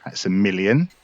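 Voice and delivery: ordinary talk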